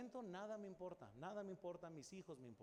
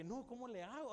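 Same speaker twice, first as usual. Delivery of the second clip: higher, anxious cry; retold desperation; 6 semitones higher, 3 dB louder than usual. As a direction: mimic a trapped, panicking father. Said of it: "estaba bien desesperado".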